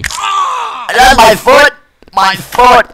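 high, stretched yell of alarm; pained outcry. Real, not acted. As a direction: cry out over the injury, voice jumping up. In-game injury outburst.

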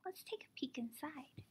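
hushed secret whisper. Acted. Teacher whispering to children before opening the book. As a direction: breathe it like sharing a secret.

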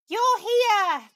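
high, loud, thrilled cry; excited cry. A narrator's reading. Toad greets Ratty, crying out with high delight. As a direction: burst out with delight at seeing him. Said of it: "Toad cried".

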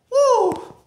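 sudden high whoop from the burn; pained high woo. Real, not acted. Wasabi burning in mouth.

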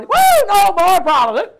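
high, loud whoop of relief; mocking the hope of a life with no problems; mock cheer. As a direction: play the naive believer celebrating. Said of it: "you're gonna find the lights gonna go on".

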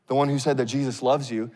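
ordinary talk, nothing marked

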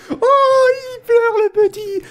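very high, slow mock crying; mocking baby wail. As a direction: whine it loudly, teasing a crybaby. Mocks the crying opponent as a baby.

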